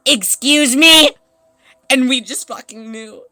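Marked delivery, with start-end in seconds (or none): 0.0-1.1 drawn-out, high, loud call; stretched mock call for attention; loud summons. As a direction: call out slowly to flag someone. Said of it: "and she said".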